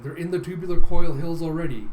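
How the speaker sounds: announcer. Acted. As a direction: give it a hint of live commentary. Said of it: "the announcer's going".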